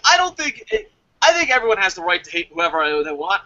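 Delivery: ordinary delivery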